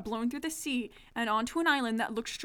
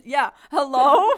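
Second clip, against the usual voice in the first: loud phone call; 2 semitones higher, 12 dB louder, slower than usual. Acted. Bobby calling down the phone.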